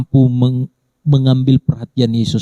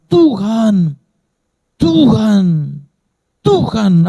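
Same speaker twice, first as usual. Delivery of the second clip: much higher, repeated calling prayer; pleading cry to God; 9 semitones higher, much slower than usual. Acted. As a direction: cry out to the Lord three times. Voiced prayer crying to God.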